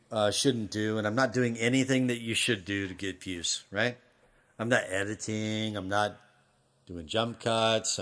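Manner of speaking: soft, tongue-in-cheek pep talk; mock heartfelt message. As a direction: talk softly to the one lonely viewer, earnest with a wink. Mock-heartfelt message to lone viewer.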